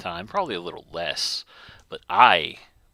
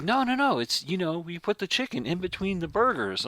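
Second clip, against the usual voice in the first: lightly higher, eager know-it-all customer; 10 semitones higher than usual. Retold or imitated. Customer explaining his secret order to the cashier, mock eager and higher.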